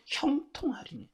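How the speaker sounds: 소리 없이 속삭이는 약속; 비밀을 알려 주듯 숨으로 말하세요; 속삭임. Acted. 말씀 끝을 속삭이며 강조. (성도들에게 약속을 속삭이듯 전함)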